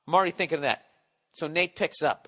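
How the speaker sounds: ordinary talk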